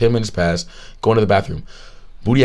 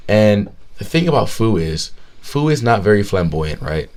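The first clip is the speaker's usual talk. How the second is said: ordinary talk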